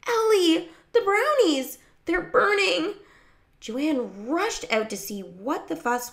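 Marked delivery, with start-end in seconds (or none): 0.1-3.0 high, loud alarmed shout; urgent high-pitched cry. A narrator's reading. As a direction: yell a warning across the yard. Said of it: "waving her piano music and shouting".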